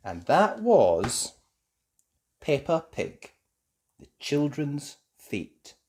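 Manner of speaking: slow, drawn-out show sign-off; closing announcer. Acted. Narrator closing the episode, slow. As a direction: announce the title. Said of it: "show closing announcement".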